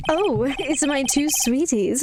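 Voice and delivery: theatrical, slow and sing-song; flamboyant showman voice. Acted. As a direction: purr it like a vain performer. Max character greeting visitors.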